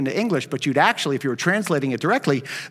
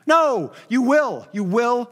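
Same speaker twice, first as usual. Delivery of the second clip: NO and WILL punched hard and higher; emphatic; 5 semitones higher, slower than usual. Shown as a demonstration. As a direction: stress No and will firmly, insisting. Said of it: "It's emphatic".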